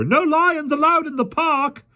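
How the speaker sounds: high, slow, loud shriek. A narrator's reading. Park keeper shrieks at the lion, very high shriek. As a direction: shriek the rules in panic.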